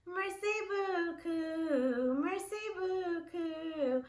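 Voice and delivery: Merci beaucoup said slowly, syllable by syllable; slow French. Shown as a demonstration. Teacher slowly modeling French thanks for students. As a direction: draw out Merci beaucoup for learners. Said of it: "Let's do French".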